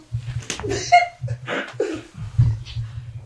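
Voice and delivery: high unvoiced laugh burst; a rueful laugh at his own trouble; breathy laugh. Real, not acted. Hiding from the police in the shop, high rueful laugh.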